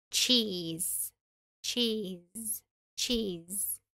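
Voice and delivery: "Cheese" with tch, a long ee and a z ending; cheese drilled. Shown as a demonstration. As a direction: model "Cheese" slowly, ending on z. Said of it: "E depois um som de Z".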